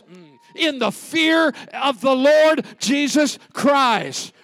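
rising preacher's shout, slowing at the end; preaching at full force. Acted. Pastor preaching to the church, building to a shout.

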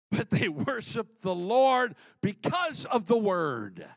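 slow, high, loud preaching cry; preaching shout. Real, not acted. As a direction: shout it out to the church, slowly. Preacher driving home his point.

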